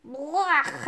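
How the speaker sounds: a drawn-out yuck after a foul drink; mild cry of revulsion; disgusted cry. A narrator's reading. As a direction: blurt out your disgust at the taste. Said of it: "I cried".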